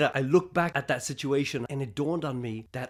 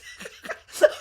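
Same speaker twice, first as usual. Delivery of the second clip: breathy laugh. Real, not acted. Laughs at his own story.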